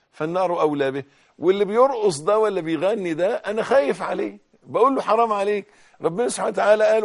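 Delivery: loud, high impassioned sermon; fiery preaching. Acted. Preacher warning sinners of hellfire, loud impassioned sermon.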